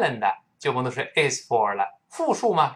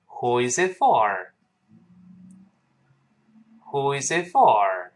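model reading for repetition. Shown as a demonstration. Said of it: "跟老师读一遍这句话".

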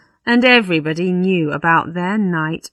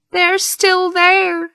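high, slow cry of alarm; dismayed parson; 13 semitones higher, 4 dB louder, slower than usual. Acted. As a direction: draw it out in horrified realisation. Parson dismayed at seeing them.

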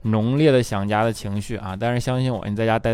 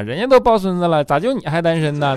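higher, louder mock nag; nagging mom; 5 semitones higher, 4 dB louder than usual. Mom nags her single adult child, higher mock voice. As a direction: mom pestering about grandkids.

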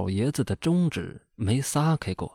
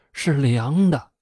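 ordinary delivery

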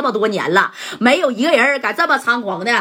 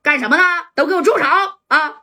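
raised, higher police shout through a loudspeaker; shouting through a bullhorn; 6 semitones higher than usual. Retold or imitated. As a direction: bark the order to stop like a cop on a megaphone. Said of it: "拿着喇叭就喊了".

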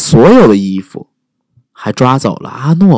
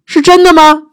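high, eager wide-eyed question; butter up the dragon; flattering princess; 6 dB louder than usual. Acted.

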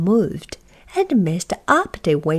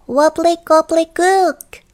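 high, louder goblin chant; goblin magic words; 8 semitones higher, 5 dB louder than usual. A narrator's reading. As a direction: squeak out the spell. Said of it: "said backwards".

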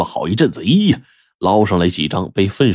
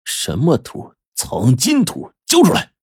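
furious demand; 8 semitones higher, slower than usual. Acted. Laowai slaps Zhang Jun and yells at him to hand it over. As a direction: build from a hiss to a yell.

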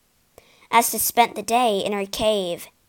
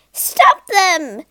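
loud, high commanding shout; Troll Queen ordering her guards, loud and high; 8 semitones higher, 8 dB louder than usual. A narrator's reading. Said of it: "the Troll Queen ordered her guards".

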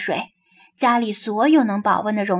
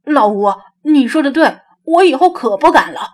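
louder, higher, chastened agreement; lingering fright; 3 semitones higher, 7 dB louder than usual. A narrator's reading. Chastened worker agreeing with his old friend, still rattled. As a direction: agree emphatically, with a touch of lingering fear. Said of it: "赵汉公心有余悸".

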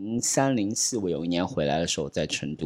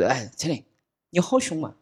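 Sichuan dialect; 7 semitones higher than usual. Retold or imitated. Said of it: "而我用四川话讲的".

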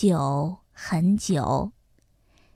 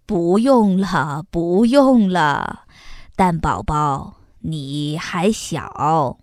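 gentle father; 7 semitones lower, slower than usual. A narrator's reading. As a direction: tell her kindly she is too small. Father voiced in story. (温和低沉的爸爸，慢慢说)

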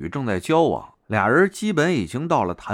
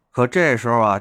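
ordinary delivery

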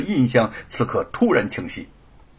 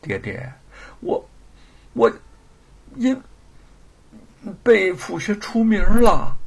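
sad hesitation; 9 semitones higher, 5 dB louder than usual. Acted. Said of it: "神情又变得忧伤起来".

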